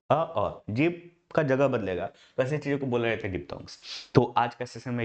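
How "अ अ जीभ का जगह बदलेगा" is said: ordinary talk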